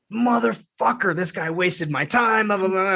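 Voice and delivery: mock-annoyed rant, starting high; griping about a wasted evening; grumbling and bitching. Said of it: "I'm, like, grumbling and bitching".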